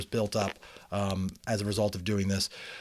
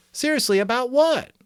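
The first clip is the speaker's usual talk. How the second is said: put-on much higher, louder eager child's question; little sister Lily; 12 semitones higher, 7 dB louder than usual. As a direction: pipe it up as curious young Lily. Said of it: "Lily asked".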